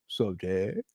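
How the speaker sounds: low, soft and offhand; too-cool kid. Retold or imitated. Dad imitating his too-cool son, low and soft offhand. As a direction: mumble it like a bored teen.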